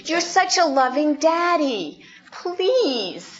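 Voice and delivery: put-on butter up mommy and daddy like a kid; flattering child. Child flattering parent in the store, high sweet-talking.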